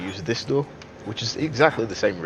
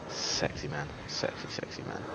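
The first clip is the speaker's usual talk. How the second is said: mock seductive whisper; 4 semitones lower, 9 dB quieter than usual. Joke flirting with monster.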